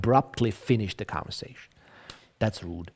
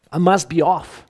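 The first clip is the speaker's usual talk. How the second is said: "must" pushed higher and louder; lively exit phrase; 6 semitones higher, 6 dB louder than usual. Shown as a demonstration. Teacher models leaving a chat, bright and louder. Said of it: "This is the phrase we had".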